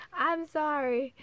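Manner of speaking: giggly, sheepish sorry; laughing apology. Real, not acted. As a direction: apologize while still laughing.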